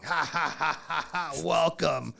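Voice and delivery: slow, mock-triumphant laugh into a drawn-out word; imitated laughter. Dabber friend laughing down the phone, imitated laughing welcome. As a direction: imitate a friend's knowing laugh, a little loud. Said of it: "he just starts laughing".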